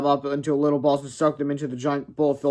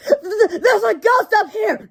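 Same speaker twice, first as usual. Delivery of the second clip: much higher, slow stammering panic; terrified cry; 6 dB louder, slower than usual. Acted. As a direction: cheerleader scared to tears. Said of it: "cried Wendy Swan".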